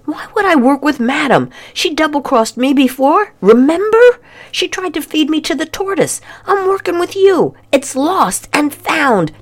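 put-on high-pitched girl voice, wounded and insistent; Lorelei pleading. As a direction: plead your innocence as a cornered girl. Lorelei pleading her case.

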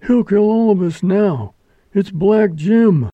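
high, loud alarm; high-pitched panicky warning; frightened passenger. A narrator's reading. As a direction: cry it out in dread. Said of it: "breathed a voice from the stage".

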